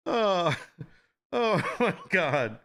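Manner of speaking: ordinary talk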